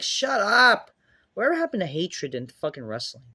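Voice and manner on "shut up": slow, high, loud yell; stretched-out angry outburst; exasperated shout. Real, not acted. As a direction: yell it drawn out to cut them off. Snaps back at mocked quote.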